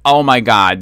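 ordinary talk, nothing marked

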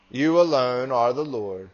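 normal talking voice

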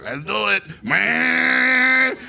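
a high, loud workman's whoop; hammed-up shout. Leaf blower crew cheering each other on, loud hammed-up whoop. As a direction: yell it like a leaf blower guy revving up.

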